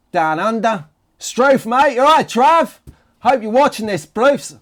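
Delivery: hammed-up, high-pitched Aussie accent; terrible Australian accent. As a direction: call out to a mate in bad mock Australian. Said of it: "that was a terrible Australian accent".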